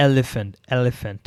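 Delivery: elephant drawn out slowly, e-le-phant; teacher stretches elephant; slow model word. Shown as a demonstration. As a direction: say elephant very slowly with a clear e start. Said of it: "এলিফ্যান্টের আগে এ আসছে".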